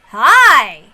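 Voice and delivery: loud, bright shouted hi; sudden shout. Real, not acted. Streamer suddenly yelling hi at chat, loud. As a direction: burst in with a shouted greeting.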